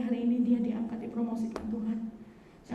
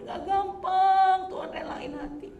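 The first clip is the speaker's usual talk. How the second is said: loud, slow, higher wailing prayer; crying prayer; 5 semitones higher, 6 dB louder than usual. Retold or imitated. Pastor reliving her crying prayer to God, loud and slow. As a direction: cry it out to God. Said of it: "Saya nangis sama Tuhan".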